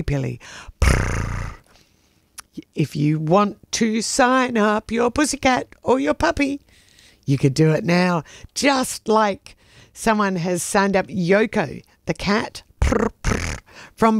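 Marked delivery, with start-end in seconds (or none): 0.8-1.5 low rolled purr; imitated cat purr. Host thanking pet patrons on air, purring. 12.8-13.6 two low rolled purrs; low trilled purring, like a contented cat; imitated cat purr. Host announcing Yoko the cat's sign-up, purring.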